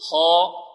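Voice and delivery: KHAA as a full, heavy "khaa", held long and loud; heavy letter. Shown as a demonstration. Teacher models letters for class to repeat, heavy held "خاء". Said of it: "انطقوا معي".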